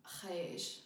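Farsi "khesh", throaty kh sound then "esh"; Farsi word khesh. Shown as a demonstration. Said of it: "there's this word in Farsi".

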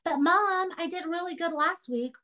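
put-on high-pitched kid appealing to Mom; pleading child. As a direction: plead your case like a kid. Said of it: "Any parent here is gonna get that".

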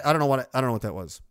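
usual speaking voice

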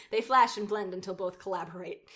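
normal talking voice

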